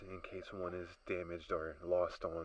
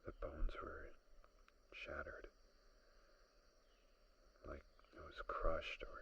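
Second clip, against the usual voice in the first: hushed dread; 2 semitones lower, 5 dB quieter than usual. Acted. Describing crushed bones.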